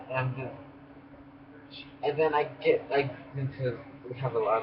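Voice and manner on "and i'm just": exhausted whisper. Real, not acted. Exhausted trailing off.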